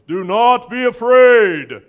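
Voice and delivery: loud, slow, commanding Moses voice; booming Moses. Shown as a demonstration. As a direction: thunder it out like Moses on the shore. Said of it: "if I say it like Moses".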